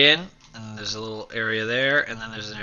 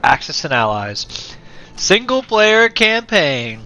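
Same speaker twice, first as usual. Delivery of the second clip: energetic intro; 3 semitones higher, 10 dB louder than usual. Acted. YouTuber greeting viewers to his campaign video, loud upbeat intro. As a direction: pump up the channel welcome.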